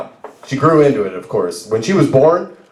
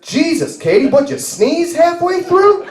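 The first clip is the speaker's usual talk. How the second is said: high, incredulous imitation of a friend; mock shock climbing very high; appalled disbelief; 13 semitones higher than usual. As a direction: blurt it aghast, dragging out the question. Friend appalled by the baby's looks.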